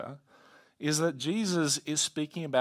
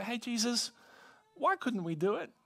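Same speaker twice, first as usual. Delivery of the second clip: lightly voiced, higher-pitched questioner; puzzled disciples; 8 semitones higher than usual. Disciples asking Jesus about their failure, lightly acted puzzlement. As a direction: ask it high, like a puzzled follower.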